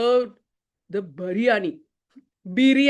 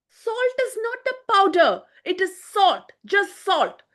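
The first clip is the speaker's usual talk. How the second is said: higher, insistent professor setting him straight; mild mock exasperation; exasperated correction; 9 semitones higher, 3 dB louder than usual. A narrator's reading. Professor correcting his cook about salt, mildly exasperated.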